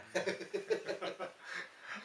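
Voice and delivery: loud chuckling burst; breathy, high laugh out loud. Real, not acted. Teacher joking with colleague about once having babies, laughing.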